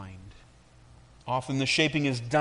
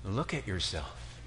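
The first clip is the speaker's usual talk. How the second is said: much lower, soft and slow; tender master; 6 semitones lower, 9 dB quieter than usual. Acted. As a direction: gently invite her to look. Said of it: "he handed me a mirror and said".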